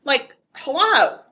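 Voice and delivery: slow, drawn-out sarcastic hello. Real, not acted. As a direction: say it like it's obvious. Sarcastic obvious point.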